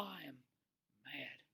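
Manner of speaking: very soft, falling confession; hushed admission. Real, not acted. Preacher admitting to congregation, in a sudden hush. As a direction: let the voice drop away to almost nothing.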